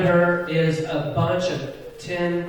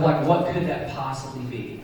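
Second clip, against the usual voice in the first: normal talking voice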